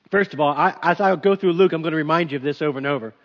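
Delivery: ordinary delivery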